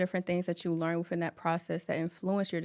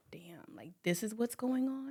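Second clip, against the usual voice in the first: whisper; 3 semitones higher, 9 dB quieter than usual. Retold or imitated. Inner realization quote.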